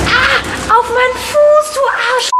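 sharp yell of pain. Real, not acted. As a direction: yell out from the hurt foot. Drone hits her foot.

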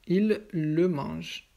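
"il le mange" drawn out slowly, weight on "le"; emphasis on LE. Shown as a demonstration. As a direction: stretch "il le" slowly for learners. Teacher models object pronoun. (insister lentement sur « le »)

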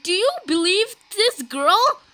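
ordinary talk, nothing marked

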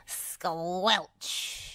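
slow, squishy sound-effect word; squelch noise. A narrator's reading. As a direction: draw out the squelch. Narrator voices a squelch sound effect.

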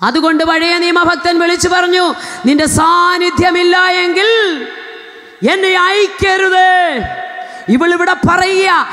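high, sung-out shouting preacher; preaching at full force. Real, not acted. Preacher sings out the warning to the congregation, high and shouting. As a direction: lift the whole church with a singing cry.

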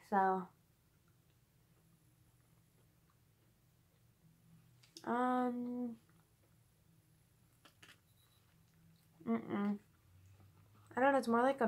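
chewing while talking; tasting candy, chewing slowly; mouth full. Real, not acted. Seen 0: Puts candy in mouth at -5, then chews with closed mouth while talking.

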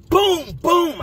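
high punchy boom boom imitation; door kicks. Inmate imitating kicking his cell door. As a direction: mimic heavy kicks on metal.

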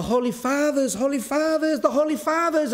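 high, rising mock cries of Holy Fathers; mock shouting. Zealot woman screaming in the street, high rising mock shout. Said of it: "she was shouting in the street".

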